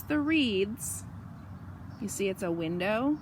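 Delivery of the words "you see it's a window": usual speaking voice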